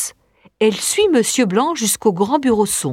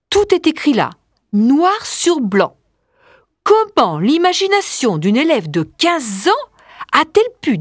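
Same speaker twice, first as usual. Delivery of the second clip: raised pitch, emphatic disbelief; lightly acted alarm, leaning on key words; 5 semitones higher than usual. Teacher realises a pupil discovered them, alarmed.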